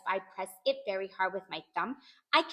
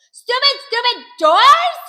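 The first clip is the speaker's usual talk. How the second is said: high, loud, slow cross yell; hollering; 12 semitones higher, 16 dB louder, slower than usual. A narrator's reading. Child locked out of both bathrooms, hollering. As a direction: stretch each word into a furious holler. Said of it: "I hollered".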